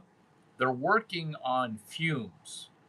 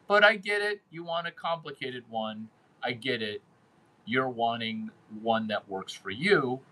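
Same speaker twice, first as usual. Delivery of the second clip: usual speaking voice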